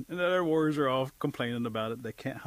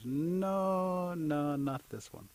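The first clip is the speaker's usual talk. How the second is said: long, high drawn-out no, then easing down; picky girl dragging out her no; picky refusal; 5 semitones higher, much slower than usual. Acted. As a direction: turn the suitor down slowly. Picky girl turns down suitor.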